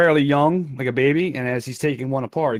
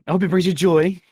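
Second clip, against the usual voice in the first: toymaker voice; 6 semitones higher, 5 dB louder than usual. Acted. Toymaker thanking buyer.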